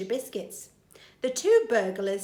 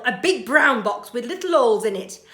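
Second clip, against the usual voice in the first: matter-of-fact burglar; 3 semitones higher, 9 dB louder, faster than usual. Acted. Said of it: "said Burglar Bill".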